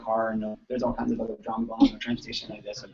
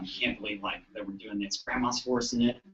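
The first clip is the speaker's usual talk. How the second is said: lower, much softer sulky daughter; sulky mutter; 6 semitones lower, 7 dB quieter than usual. Retold or imitated. Skeptical daughter complaining about grandma, low and soft. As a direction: grumble it under your breath. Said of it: "She's like, she's like".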